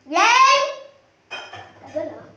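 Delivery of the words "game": loud shout to start the game; calling out. Real, not acted. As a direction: yell it across the house. Calling out in hide and seek.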